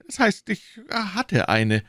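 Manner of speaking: slow, high, hesitant correction; nervous hedging. A narrator's reading. Shopkeeper correcting himself about his wife, nervous and high. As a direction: backtrack anxiously, high and drawn out.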